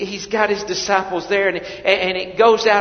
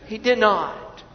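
normal talking voice

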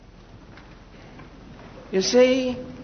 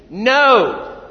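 loud, firm, higher no; 7 semitones higher, 7 dB louder than usual. Shown as a demonstration. Preacher drilling the congregation on a firm no. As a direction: plant your feet and say no. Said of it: "Let's try it again".

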